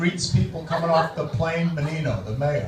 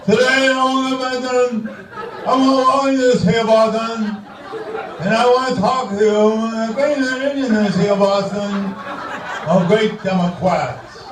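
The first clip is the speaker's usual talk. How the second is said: Menino impression; 4 semitones higher than usual. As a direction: stumble through a speech like Mayor Menino. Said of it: "my tribute to the outgoing mayor, Tom Menino".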